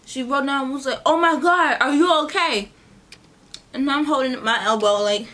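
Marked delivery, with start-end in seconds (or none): 1.0-2.7 high, loud, startled "are you okay"; a bystander gasping out her worry; alarmed concern. Retold or imitated. Startled lady shouting to the girl she hit, loud and worried.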